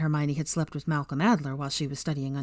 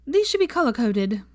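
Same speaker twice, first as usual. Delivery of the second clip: higher, decided Pansy voice; 7 semitones higher, 5 dB louder than usual. A narrator's reading. Pansy deciding how to sort the bookshelf, decided. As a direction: announce it as settled. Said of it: "she said decidedly".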